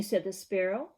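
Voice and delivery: ordinary delivery, nothing marked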